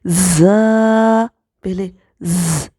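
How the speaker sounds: ز held long with the teeth together; buzzing z. Shown as a demonstration. Teacher shows the whistling ز sound. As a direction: buzz out ز slowly. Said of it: "تو اس کو پڑھیں گے".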